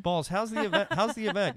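bright, loud host greeting; excited. Real, not acted. Host calling out to guest.

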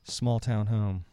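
lightly gruff storybook read; audiobook narration. A narrator's reading. Reading a made-up story aloud as the narrator, mock serious. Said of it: "I'm gonna read it in a gruff, gruff voice".

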